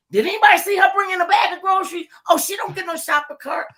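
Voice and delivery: high, loud retold taunt; mock-indignant mother. Acting out angry mother's mockery.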